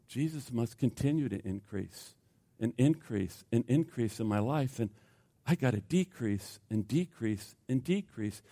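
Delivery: low, very soft and measured; hushed quote. Acted. Preacher voicing John the Baptist's answer, hushed. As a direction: drop right down to a quiet, humble confiding. Voices John's humble secret after outburst.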